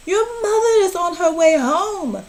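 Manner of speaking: high, loud, frantic warning; the fish in full-blown panic; panicky fish. A narrator's reading. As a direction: fret aloud as mother nears. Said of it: "Our fish shook with fear".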